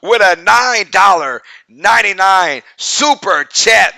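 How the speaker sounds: high, loud hype for a donor; stretched-out loud shout-out; excited shout-out. Real, not acted. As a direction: hype up the super chat. Hyping a super chat donor.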